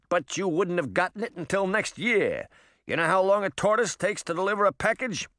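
high, fast-talking hustler rabbit; pushy rabbit. Acted. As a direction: wheedle for a tip, street-smart.

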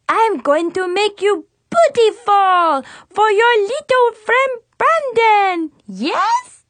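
high, loud fake French accent of a little girl; a kid hamming up a French salon hostess. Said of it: "awful fake French accent".

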